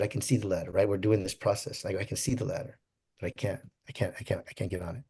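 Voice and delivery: soft, helpless, trailing off; quiet stuck voice. Retold or imitated. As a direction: say it hushed, like you're stuck. Stuck in therapy, can't climb.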